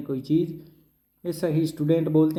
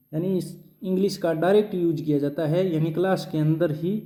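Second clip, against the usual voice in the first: normal talking voice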